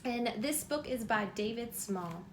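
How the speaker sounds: plain reading voice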